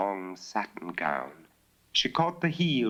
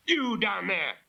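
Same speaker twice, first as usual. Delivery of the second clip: shouting; 12 semitones higher than usual. Acted. Master shouts at robbers.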